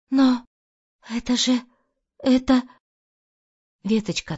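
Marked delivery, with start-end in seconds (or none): 0.1-2.6 потрясённо. Acted. Марианна узнаёт серьгу.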